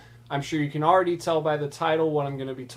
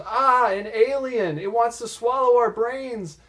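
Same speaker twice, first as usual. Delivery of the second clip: hammed-up shriek at the alien; mock scream; 8 semitones higher, 4 dB louder than usual. Girl fleeing Calamon in mock terror, high and loud. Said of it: "The girl screams and goes".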